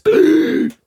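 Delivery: loud, high, sharp gasp; demonstrative gasp. Shown as a demonstration. Showing how they have been eating, exaggerated gasp. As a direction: gasp as if gulping food down. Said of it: "ingesting it like this".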